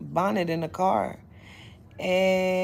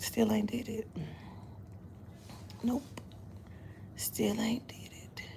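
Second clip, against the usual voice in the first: breathy whispered confession; 3 semitones higher, 11 dB quieter than usual. Real, not acted. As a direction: whisper it like a secret. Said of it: "playful whisper aside".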